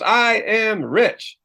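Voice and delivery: high, slow unconvinced crowd chant; doubtful crowd. Retold or imitated. Seminar crowd reciting an affirmation they don't believe, slow and high. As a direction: drag it out like a crowd that doesn't buy it. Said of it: "Everybody goes".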